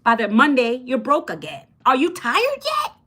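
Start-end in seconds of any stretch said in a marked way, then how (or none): 1.8-2.9 high, slow, drawn-out teasing question; hook-style challenge. Shown as a demonstration. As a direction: pitch it up and dare them. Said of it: "That's how I talk".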